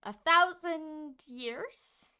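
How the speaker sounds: slow, drawn-out disbelief; stunned, drawn out; incredulous. Acted. Character shocked at the claim.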